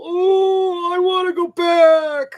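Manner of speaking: high, loud whimpering cry, hammed-up; mock whiny crying. Mocking Mikasa's tearful scene in a hammed-up high cry. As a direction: wail it like a crybaby in a parody.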